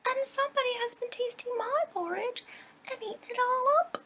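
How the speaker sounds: squeaky little bear's high pipe; piping little bear. Acted. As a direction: pipe it up like a tiny cub. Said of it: "the little bear piped".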